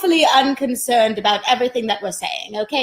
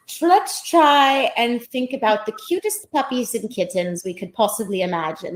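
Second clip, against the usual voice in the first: ordinary talk, nothing marked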